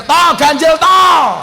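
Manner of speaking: high, loud exasperated shout; booming preacher's shout. Shown as a demonstration. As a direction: yell it at the crowd in frustration. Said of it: "nanti saya teriak".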